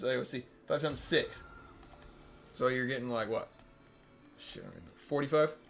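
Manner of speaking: slurred, tipsy counting. Real, not acted. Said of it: "I'm so drunk right now".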